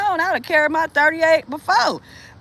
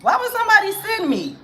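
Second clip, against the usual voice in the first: mock bewildered quote; 9 semitones higher than usual. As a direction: act out confused questioning.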